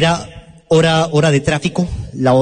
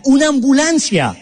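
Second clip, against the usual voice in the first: high, urgent cry of discovery; wife's shout; 9 semitones higher than usual. Retold or imitated. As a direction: call it out like you just spotted rescue. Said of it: "mi esposa grita". (grito alto y urgente)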